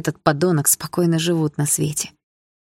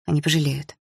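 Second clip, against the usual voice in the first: ordinary delivery, nothing marked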